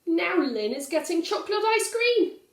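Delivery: high, loud jealous whine; whining child. Acted. Valdemar jealous of his sister's ice cream, high whine. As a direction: complain it like a jealous brother.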